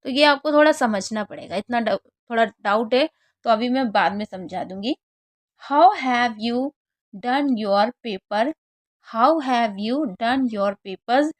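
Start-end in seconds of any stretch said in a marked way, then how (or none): 5.6-11.3 "How have you done your papers" read out very slowly, word by word. Shown as a demonstration. Teacher slowly reads the English line aloud to the class. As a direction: spell out each English word slowly for learners. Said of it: "यानी कि तुम्हारे paper कैसे हुए हैं".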